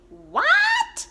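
a high, loud incredulous yelp; shocked what. Real, not acted. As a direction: blurt it out in total shock. Reacting to warm January weather.

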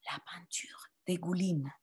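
voiceless whisper, each word soft. Shown as a demonstration. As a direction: hush it for the class to echo. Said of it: "Répétez". (chuchoté)

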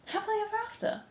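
very high, soft, small voice; whispered. A narrator's reading. Despereaux repeating it to himself, whispering. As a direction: breathe it out high and softly. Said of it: "he said again".

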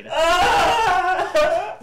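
high, loud laughing shriek. Real, not acted. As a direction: let out a cracking laugh-scream. Laughing scream at game.

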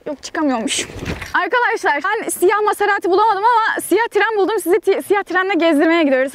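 ordinary talk

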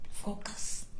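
breathy whisper, almost no voice; whispered focus. Real, not acted. Whispering focus to her confused viewers. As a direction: whisper it like a secret reminder.

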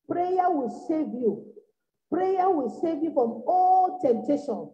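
measured sermon emphasis; slow emphatic preaching. Real, not acted. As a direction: drive the promise home slowly. Preacher repeats slowly.

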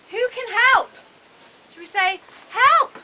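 ordinary delivery, nothing marked

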